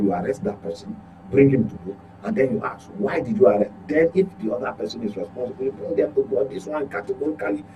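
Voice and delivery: normal talking voice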